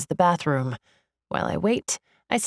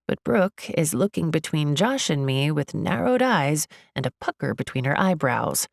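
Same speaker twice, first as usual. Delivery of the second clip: plain reading voice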